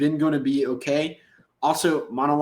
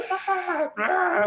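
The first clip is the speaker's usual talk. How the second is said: imitated scream held back in volume; mock nasty yell. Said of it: "Lets out this nasty yell by Brooklyn".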